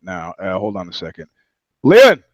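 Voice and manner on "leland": high, loud shout for someone; calling out. Real, not acted. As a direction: yell to get his attention. Calls off-mic to a man.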